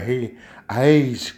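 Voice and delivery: slow, badly faked Brazilian Portuguese; half-hearted attempt at imitating Brazilian speech; mock foreign language. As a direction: fake Portuguese greeting, drawn out. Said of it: "they was doing it in their language too".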